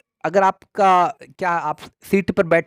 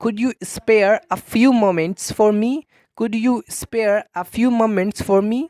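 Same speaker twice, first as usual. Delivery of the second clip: very slow, careful teacher read; slow model phrase; 3 semitones higher, much slower than usual. Shown as a demonstration. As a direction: repeat it slowly for students to copy. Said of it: "इस तरीके से कहेंगे". (धीमा, साफ़ उच्चारण)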